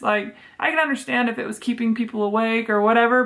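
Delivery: ordinary talk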